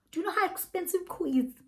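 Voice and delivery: high, fast outraged question; indignant disbelief. Real, not acted. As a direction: demand it, incredulous and high. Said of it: "indignant rant".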